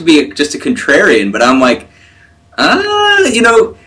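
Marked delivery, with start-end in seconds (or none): 2.6-3.8 high, drawn-out doubtful "ah"; doubtful hedge. Retold or imitated. Speaker balking at the idea, doubtful. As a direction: hem and haw high up, unconvinced. Voices own doubtful reaction.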